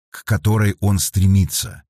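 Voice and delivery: plain reading voice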